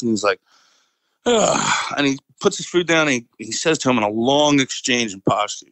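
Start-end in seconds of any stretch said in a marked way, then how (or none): 1.3-1.9 imitated disgusted "ugh"; annoyed groan. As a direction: groan like someone fed up with the person talking. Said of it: "he gives him the shitty look".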